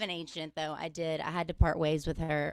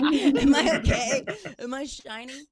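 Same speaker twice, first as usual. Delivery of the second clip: anxious self-check; 7 semitones higher, 10 dB louder than usual. Retold or imitated. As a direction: fret over your makeup under hot lights. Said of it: "just be like".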